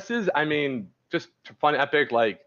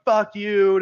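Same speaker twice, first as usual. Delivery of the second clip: a spat-out fuck you, raised; mock angry retort; 7 semitones higher, 6 dB louder than usual. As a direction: imitate a dismissive curse. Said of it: "still go like".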